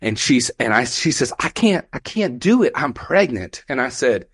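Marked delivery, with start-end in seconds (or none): 1.4-3.6 higher-pitched mock pleading; frightened refusal. Trapped pregnant lady to her rescuer, acted frightened refusal. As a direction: imitate a scared woman balking.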